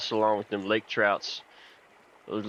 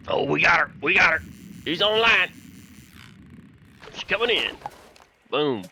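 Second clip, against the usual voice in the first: excited shout; 8 semitones higher, 4 dB louder than usual. Real, not acted. Hooking a fish in game.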